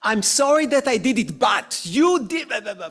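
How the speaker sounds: loud, high apology rushing into blame; apologize, then turn it into an accusation; blaming non-apology. Acted. Speaker mimics a bad apology that blames the other, loud and defensive. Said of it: "'cause so often we say".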